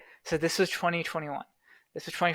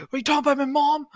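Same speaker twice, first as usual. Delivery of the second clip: higher, suspicious accusation from the roommate; a retold paranoid roommate's raised demand; mock accusing outburst; 10 semitones higher, faster than usual. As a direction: imitate the roommate snapping at you. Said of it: "Like that".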